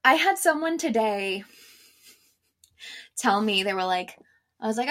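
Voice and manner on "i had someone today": ordinary delivery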